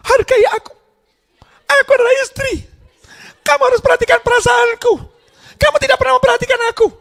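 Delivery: hammed-up complaining wife squealing her demands; demanding wife. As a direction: parody a nagging wife demanding attention. Shrill demanding wife, parody.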